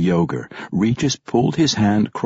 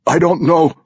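nervous; 9 semitones higher, 5 dB louder than usual. A narrator's reading. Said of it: "Kermelon started".

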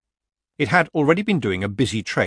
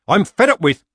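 loud, high, fed-up outburst; snapped; 9 semitones higher, 9 dB louder than usual. A narrator's reading. Nanny losing patience with the committee, snapping. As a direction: burst out crossly, voice raised. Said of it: "snapped Nanny".

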